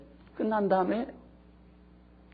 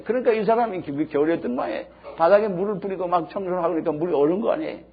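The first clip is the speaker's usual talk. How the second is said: usual speaking voice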